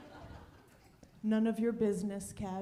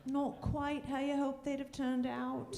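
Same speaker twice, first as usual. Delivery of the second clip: put-on English accent; 5 semitones higher than usual. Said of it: "That's how he talks about our kids".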